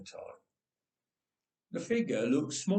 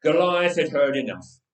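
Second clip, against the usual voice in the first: ordinary delivery, nothing marked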